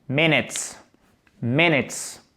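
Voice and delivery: "minutes" stressed on MIN, falling away; stress on first syllable. Shown as a demonstration. Teacher models word stress, "minutes" with MIN stressed. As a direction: hit the first syllable of "minutes", let the second drop. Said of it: "Accent tonique sur la première syllabe".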